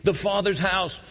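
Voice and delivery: impassioned revival preacher pleading with listeners; preaching at full force. Acted. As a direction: build the altar call with fervour. Sustained altar call preaching.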